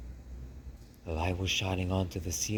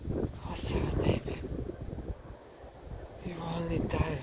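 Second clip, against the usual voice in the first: high, whispery, theatrical despair; breathy French mother mouse; 10 semitones higher, 3 dB quieter than usual. Acted. Mother lamenting her sickly son, high and whispery. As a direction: sigh it all out like a tragic diva.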